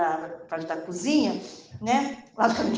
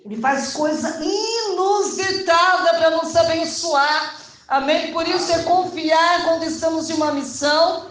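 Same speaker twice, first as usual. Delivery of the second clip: loud, high, ringing exhortation; full-force preaching; 6 semitones higher, 6 dB louder than usual. Real, not acted. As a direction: proclaim it to the congregation with force. (pregação inflamada, voz alta e projetada)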